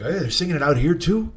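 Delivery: normal talking voice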